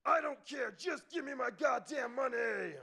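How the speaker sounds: high, strained mock yell, held back in volume; yelling meth head. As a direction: imitate an addict screaming down the phone. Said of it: "this meth head on his phone ... just yelling".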